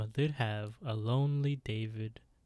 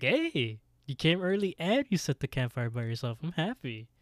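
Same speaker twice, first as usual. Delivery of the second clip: higher, louder pleased greeting; cheerfully praising, pitched up; proud; 4 semitones higher, 4 dB louder than usual. Retold or imitated. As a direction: imitate a proud, happy arrival. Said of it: "and he's proud".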